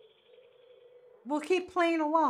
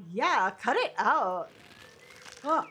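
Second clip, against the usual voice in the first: ordinary delivery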